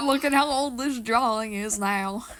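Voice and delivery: slow, put-on Western accent; mock Western accent, slow. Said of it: "Now I'm doing it in a Western accent".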